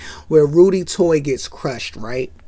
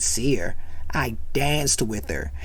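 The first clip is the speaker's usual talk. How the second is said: cool Roach; 7 semitones lower, 8 dB quieter than usual. Retold or imitated. Roach bragging in low voice.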